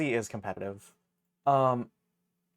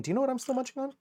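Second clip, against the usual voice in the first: much higher, squeaky cartoon voice; 12 semitones higher, 4 dB louder, much faster than usual. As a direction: squeak the question playfully. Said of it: "repeat in other voice".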